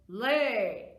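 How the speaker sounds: loud, drawn-out commanding yell; giant's yell. Acted. Giant ordering his hen in a children's story, yelling. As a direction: bellow it as a one-word order. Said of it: "the giant yelled".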